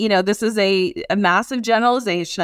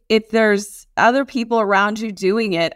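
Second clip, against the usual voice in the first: normal talking voice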